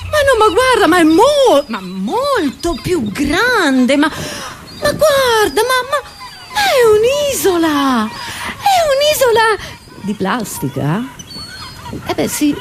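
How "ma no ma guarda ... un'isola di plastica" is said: high, drawn-out amazement; astonished. Acted. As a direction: swoop up high and stretch the vowels in awe. Acted amazement at huge island. (stupore acuto e dilatato)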